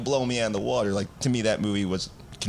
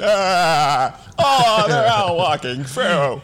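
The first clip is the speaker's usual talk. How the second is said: slow, loud awestruck gasp, pitched up; starstruck fanboy gasp; 5 semitones higher, 6 dB louder, much slower than usual. Retold or imitated. Fan on a podcast mocking himself and his friend, awestruck slow gasp. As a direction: gush like a man child seeing heroes assemble. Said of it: "every man child, you and me included".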